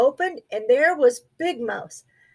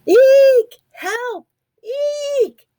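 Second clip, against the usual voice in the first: very high, loud squealing eeks; a panicked mouse squeaking for help; terrified squeaks; 12 semitones higher, 6 dB louder, much slower than usual. A narrator's reading. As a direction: shriek for help like a tiny mouse. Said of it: "squeaked Little Mouse".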